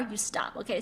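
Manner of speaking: normal talking voice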